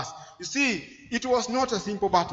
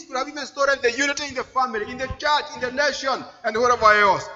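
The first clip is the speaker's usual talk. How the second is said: raised, insistent preaching voice; impassioned preaching; 4 semitones higher, 4 dB louder than usual. Real, not acted. Preacher listing what the devil has stolen, impassioned preaching. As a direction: pile up the losses with mounting insistence.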